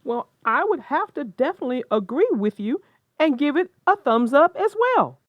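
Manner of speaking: big excited agreement; enthusiastic. Real, not acted. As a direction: hype the thumbs up. Enthusiastic thumbs up.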